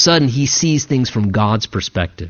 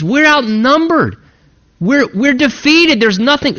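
higher, panicked voice of the servant; terrified servant; 8 semitones higher than usual. Retold or imitated. Servant panics at the surrounding army, voiced higher. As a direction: let the fear push the pitch up. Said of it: "right, he's terrified".

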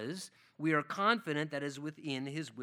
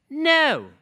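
firm no; 10 semitones higher, 13 dB louder than usual. Acted. Father refusing child.

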